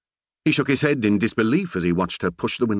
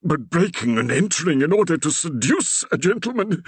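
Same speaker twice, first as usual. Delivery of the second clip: put-on high, despairing old vicar; groaning Reverend; 9 semitones higher than usual. A narrator's reading. The Reverend groaning to Percy in disbelief. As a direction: groan it out in dismay. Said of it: "Augustus Shackleford groaned".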